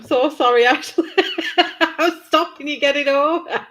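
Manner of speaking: high, loud apology through real laughter; laughing hard. Real, not acted. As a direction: gasp it out while cracking up. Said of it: "I'm laughing so hard".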